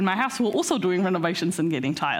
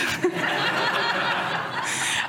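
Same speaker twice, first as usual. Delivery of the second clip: high giggling laugh; real laugh; 10 semitones higher than usual. Real, not acted. Preacher laughing at own joke, high giggle. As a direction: laugh at the joke just made. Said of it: "laughs at own joke".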